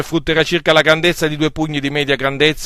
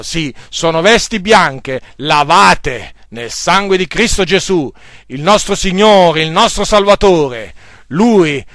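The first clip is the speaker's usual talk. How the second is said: preaching at full force; 2 semitones higher, 4 dB louder than usual. Acted. Preacher urging the brethren to trust God's word, full-force preaching. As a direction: thunder the truth of Scripture at the congregation.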